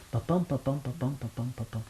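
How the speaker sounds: "papam" repeated softly with the weight on the second syllable, pa-PAM; iambic beat. Shown as a demonstration. Translator beating out the pentameter for the interviewer, soft pa-PAM pulse. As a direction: tap out the rhythm quietly. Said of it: "Ce vers-là".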